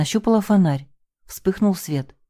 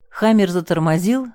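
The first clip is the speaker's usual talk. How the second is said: plain reading voice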